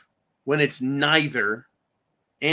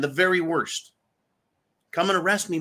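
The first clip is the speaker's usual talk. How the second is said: normal talking voice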